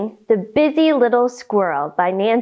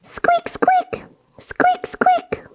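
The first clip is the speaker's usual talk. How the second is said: mouse squeaks; much slower than usual. Pretend. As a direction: squeak tiny like a teeny mouse. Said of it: "One more time".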